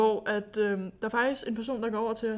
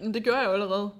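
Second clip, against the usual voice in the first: usual speaking voice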